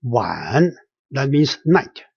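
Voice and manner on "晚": 晚 pitched low, going down and then up; third tone. Shown as a demonstration. Teacher models down-then-up tone, 晚 dipping low and rising. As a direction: let 晚 sink and climb back. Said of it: "going down and going up".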